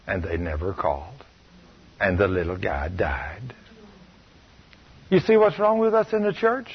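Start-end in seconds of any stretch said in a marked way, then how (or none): 0.1-3.5 whispered, almost breathless; hushed. Real, not acted. Preacher whispering the boy's death to the congregation. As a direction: let the ending drop to a whisper. Sad end of hospital story.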